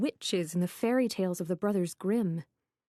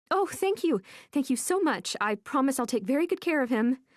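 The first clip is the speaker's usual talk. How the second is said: high, louder, gushing gratitude; overjoyed thanks; 5 semitones higher, 4 dB louder than usual. Acted. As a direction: gush your thanks, thrilled. Overjoyed at getting the cat.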